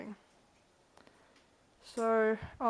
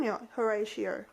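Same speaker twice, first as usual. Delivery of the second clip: ordinary delivery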